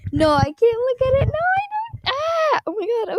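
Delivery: high, loud overwhelmed squeal; freaking out. Real, not acted. Streamer can't look at the new item, freaking out. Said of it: "I'm freaking out".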